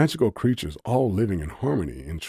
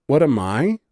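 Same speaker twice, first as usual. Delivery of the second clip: louder, incredulous scoff; 8 semitones higher, 8 dB louder than usual. A narrator's reading. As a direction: throw the question back in disbelief. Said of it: "Cynthia scoffed".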